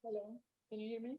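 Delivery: far from the microphone; faint audio. Real, not acted. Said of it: "I mean, very low".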